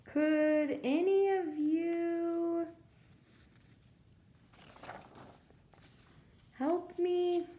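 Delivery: a shy bear begging for help; lightly voiced bear, high and drawn out; pleading bear voice. Bear asks animals politely for help.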